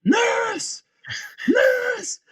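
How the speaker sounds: high, strained mock yell; yelling for help. Brother calling as sister walks off, yelling for help. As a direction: call out in alarm, pitch right up. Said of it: "I start yelling".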